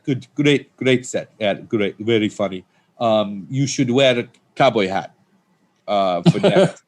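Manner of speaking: put-on Persian accent. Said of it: "known for being very eccentric".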